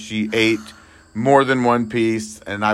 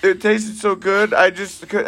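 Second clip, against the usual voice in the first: mock wife excuse; 10 semitones higher than usual. Wife's excuse voiced.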